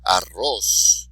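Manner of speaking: Arroz with a loud final "rroz"; stress on last syllable. Shown as a demonstration. Teacher modelling words for students, last syllable of Arroz stressed. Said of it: "accent on the last syllable".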